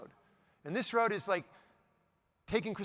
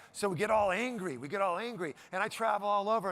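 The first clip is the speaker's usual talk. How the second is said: ordinary delivery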